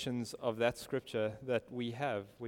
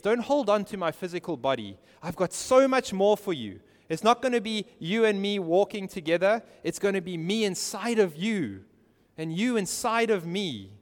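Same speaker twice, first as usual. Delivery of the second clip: raised, urgent pulpit voice; impassioned preaching; 6 semitones higher, 6 dB louder than usual. Acted. Preacher voicing Jesus speaking to Mary, impassioned. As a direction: press the promise home warmly but firmly.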